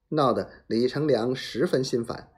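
usual speaking voice

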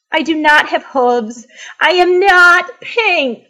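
rising to high, loud protest; upset, climbing to an emphatic wail; building upset. Acted. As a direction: build into a distressed outburst.

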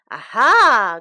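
loud triumphant discovery; crying out. Acted. Shen catches the beggar out, crying out. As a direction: pounce on the discovery with a loud cry. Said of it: "cried Shen".

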